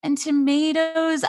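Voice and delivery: very slow, high, timid and onions; timid order. Retold or imitated. Ordering at the omelet station, timid and slow. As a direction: order meekly, dragging each word. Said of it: "You're like".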